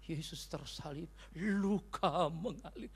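very slow, drawn-out lament; mournful preacher. Retold or imitated. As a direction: mimic tear-jerking preaching, stretching each word mournfully. Imitates his tear-jerking crucifixion preaching.

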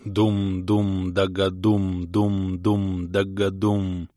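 slow, rhythmic drum chant; imitated drumbeat. A narrator's reading. Drums of mountain trolls roll for miles, slow rhythmic booming. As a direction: intone it slowly like rumbling drums. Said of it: "Рокочущие звуки барабанов".

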